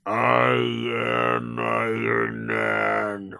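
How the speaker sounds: slow, solemn movie-hero delivery; dramatic superhero quote, very slow; Iron Man line. Retold or imitated. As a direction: deliver it like the climactic film line, dragged out. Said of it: "so I'll just say".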